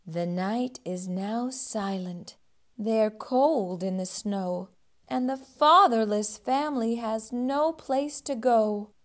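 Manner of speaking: usual speaking voice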